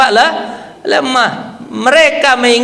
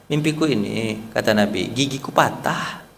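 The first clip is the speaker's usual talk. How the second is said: hushed dream telling; 9 semitones lower, 9 dB quieter than usual. Acted.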